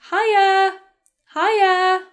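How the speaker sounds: high, loud, friendly call; party greeting. Shown as a demonstration. Teacher saying what she might say at a party, high and loud.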